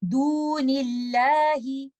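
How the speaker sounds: Dunillahi recited slowly with a light lam; light lam recitation. Shown as a demonstration. Teacher recites Dunillahi for students, slowly. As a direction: draw out Dunillahi slowly, keeping the lam thin. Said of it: "We will recite it like this".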